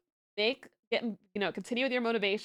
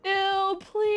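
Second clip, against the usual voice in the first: disgusted; 10 semitones higher, 5 dB louder than usual. Real, not acted. Reacting to sweaty controller.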